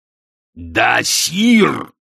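barked. A narrator's reading. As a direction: bark the correction at an underling. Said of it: "рявкнул Астфгл". (рявкнуть громко, с нажимом)

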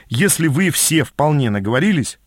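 ordinary delivery

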